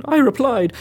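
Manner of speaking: ordinary delivery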